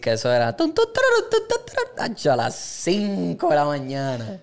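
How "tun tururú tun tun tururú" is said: high sung bugle call, tun tun tururú; imitated bugle call. Recalling army basic training, imitates the bugle call high and sung. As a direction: mimic a reveille trumpet in a high voice.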